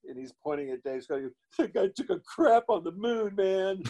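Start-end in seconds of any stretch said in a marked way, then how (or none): 1.6-3.8 high-pitched imitation of an amazed buddy; Bill Paxton. Actor imitates friend pointing at the astronaut, high-voiced impression. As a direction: mimic a friend marveling, voice pitched way up. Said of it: "the only way Bill can do it".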